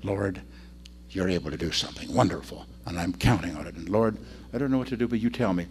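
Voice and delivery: low, soft prayer. Acted. Preacher models praying in a crisis, hushed trust. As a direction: confide it quietly to God. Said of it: "here's the place to turn to".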